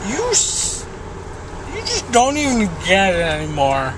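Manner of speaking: ordinary delivery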